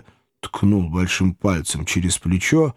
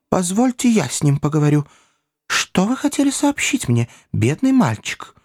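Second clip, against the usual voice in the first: much higher, gentle coaxing; kindly Pickwick; 9 semitones higher than usual. A narrator's reading. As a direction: coax the poor boy. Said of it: "мистер Пиквик". (ласково уговаривает мальчика)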